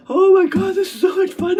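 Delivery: high, loud exclamation breaking into laughs; delighted laughter. Real, not acted. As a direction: exclaim it while cracking up.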